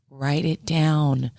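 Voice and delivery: very slow, spelled-out urging; slow insistent. Retold or imitated. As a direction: drag each word out to insist. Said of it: "we always kept saying".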